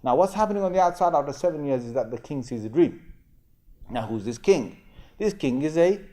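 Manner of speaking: ordinary talk, nothing marked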